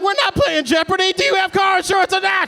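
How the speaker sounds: high, loud, fed-up yell; mock angry outburst at the other driver; exasperated shout. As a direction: lose patience and shout at her. Angry driver confronting the woman.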